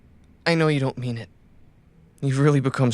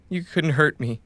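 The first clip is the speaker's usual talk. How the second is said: normal talking voice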